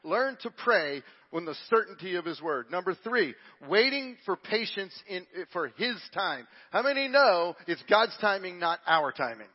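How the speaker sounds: ordinary delivery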